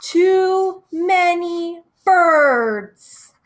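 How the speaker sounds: slow, drawn-out, loud and high-pitched complaint; angry grumble. Acted. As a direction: stretch every word like a furious old bird. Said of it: "He's very angry now".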